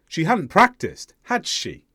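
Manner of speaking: "had" stressed, falling through "she". Shown as a demonstration. Teacher replays second example expecting agreement, "had" stressed, falling. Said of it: "the stress is on the auxiliary verb".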